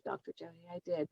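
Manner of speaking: normal talking voice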